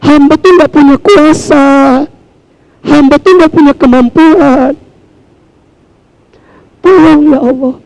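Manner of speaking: humble sincere plea; earnest humble prayer. Shown as a demonstration. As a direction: plead to God as the weak servant. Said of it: "Ibu tuh kudu minta bener".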